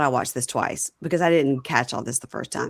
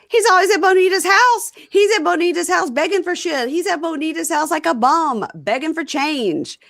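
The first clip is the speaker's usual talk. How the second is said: mock outraged rant; 10 semitones higher, 3 dB louder than usual. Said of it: "And Bonita goes".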